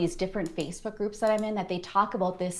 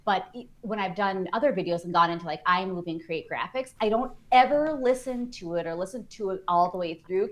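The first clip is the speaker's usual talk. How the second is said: ordinary talk, nothing marked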